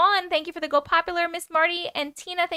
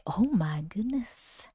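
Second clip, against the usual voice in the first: slow, breathy whispered gasp; whispering; 5 semitones lower, 4 dB quieter, much slower than usual. Real, not acted. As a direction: whisper it in awe. Whispered exclamation.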